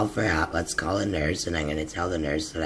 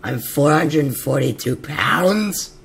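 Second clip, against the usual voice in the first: incredulous; 9 semitones higher, 9 dB louder, slower than usual. Real, not acted. Reading own weight on scale.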